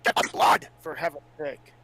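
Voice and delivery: ordinary talk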